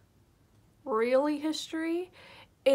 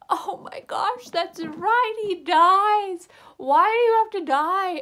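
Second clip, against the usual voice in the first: higher and louder anguished cry; distraught fan yelling at the book; ranting outcry; 6 semitones higher, 8 dB louder, slower than usual. Retold or imitated. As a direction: wail it as if a favourite just died. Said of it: "sorry, had a rant".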